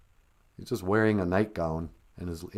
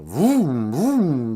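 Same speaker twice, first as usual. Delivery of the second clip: chainsaw vroom; 4 semitones higher, 7 dB louder than usual. Retold or imitated. Chainsaw noise.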